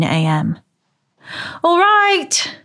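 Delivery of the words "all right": high, long-held call; exasperated call to someone at the door. A narrator's reading. Moira answers the early doorbell, calling out. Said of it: "she called out".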